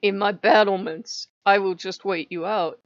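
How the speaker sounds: loud stubborn siege vow; defiant declaration. Retold or imitated. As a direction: declare it from the battlements. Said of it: "I'm like".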